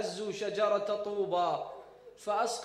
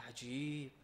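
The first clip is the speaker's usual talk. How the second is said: a whispered "عجيب" of quiet amazement; hushed wonder; 6 semitones lower, 10 dB quieter, slower than usual. Retold or imitated. The Prophet whispers his wonder to Umm Ayman. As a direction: breathe it out in soft surprise. Prophet marvels at her crying.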